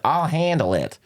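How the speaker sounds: a Brit's shaky stab at American; Brit doing American. Retold or imitated. Guest mimicking Brits doing an American accent. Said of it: "that's my impression of every person from the U K doing an American impression".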